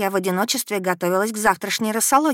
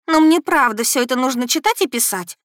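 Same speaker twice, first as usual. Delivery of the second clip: whining; 6 semitones higher, 5 dB louder than usual. A narrator's reading. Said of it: "начала канючить Эльза".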